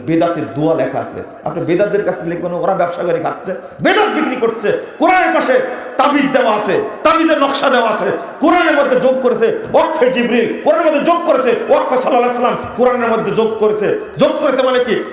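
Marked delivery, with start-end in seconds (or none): 3.8-15.0 high, insistent raised voice; heated denunciation. Real, not acted. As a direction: hammer out each accusation. Denounces additions made to the Quran. (উত্তেজিত গলায় ধিক্কার)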